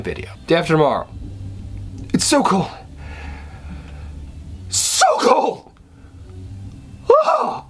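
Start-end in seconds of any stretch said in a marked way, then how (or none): 2.1-7.6 very high, strained cry of cold; hammed-up freezing. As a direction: overact freezing to death. Acting out freezing film scene.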